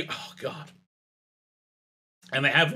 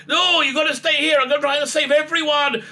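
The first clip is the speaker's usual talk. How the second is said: mock heroic reply; 11 semitones higher, 3 dB louder than usual. Doctor answers the plea.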